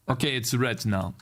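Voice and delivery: ordinary talk, nothing marked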